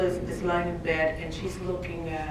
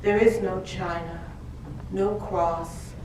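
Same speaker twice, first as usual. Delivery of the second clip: measured, louder recital with long pauses; poetry reading; 4 semitones higher, 5 dB louder than usual. Acted. Reads her poem aloud.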